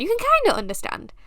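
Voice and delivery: a squeaky, hurried parody of her own line; high, fast mock repeat. Mocking her own Urdu to the viewers, high and fast.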